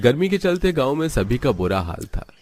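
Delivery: ordinary delivery, nothing marked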